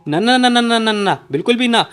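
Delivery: high, loud, rapid string of no's; flustered refusal. A narrator's reading. Girl embarrassed by Shinchan's request, high flustered refusal.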